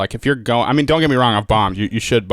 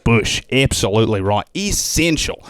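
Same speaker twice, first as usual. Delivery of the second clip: put-on Australian accent; 2 semitones higher than usual. Comic warning the host about snakes in an Aussie accent. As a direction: play the cheery Australian guide. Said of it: "accents are kind of my deal".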